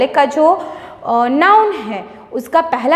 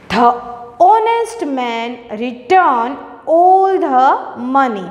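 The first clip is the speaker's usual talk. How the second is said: slow read-aloud; much slower than usual. Shown as a demonstration. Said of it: "sentences को read करते हैं".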